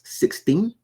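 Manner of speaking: SIXTEEN rising high as a question; lifted, asking "Sixteen?". Retold or imitated. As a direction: quote SIXTEEN as a query, pitch climbing. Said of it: "He then asks".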